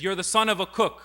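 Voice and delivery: retold verbal attack. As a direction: hurl it as an insult. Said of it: "he attacks him again".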